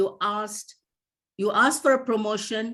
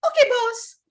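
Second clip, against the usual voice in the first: high, put-on doll voice; meek employee; 14 semitones higher, 5 dB louder than usual. Miss Bobby answers the boss's offer of a seat, high and put-on. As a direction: squeak out a loud, eager reply.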